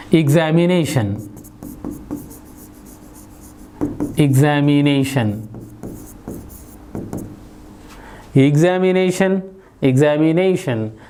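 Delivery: examination with the stress on "NA", the syllable before -tion; stress near the end. Shown as a demonstration. Teacher demonstrates word stress to students, examination stressed on "NA". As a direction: say examination leaning on "NAY". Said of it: "stress is on N".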